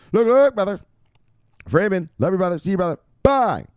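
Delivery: high, loud catchphrase bursts; high hype-man calls; hyped shout-out. Pretend. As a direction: fire off each greeting way up high. Calling out shout-outs to followers.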